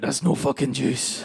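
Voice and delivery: put-on low, slow Glasgow accent; gruff, drawn-out Glaswegian scorn; Glaswegian girlfriend. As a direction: grumble it in surly Glaswegian. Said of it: "She went".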